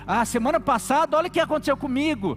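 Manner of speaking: whiny complaint. Pretend. Preacher mimics whining complainer.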